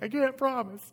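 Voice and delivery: high imitation of wife near tears; wife choked up. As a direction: squeak it out like a tearful wife. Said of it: "And Karen's like".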